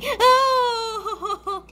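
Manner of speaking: high shrieking laugh; panicked laughing scream. Real, not acted.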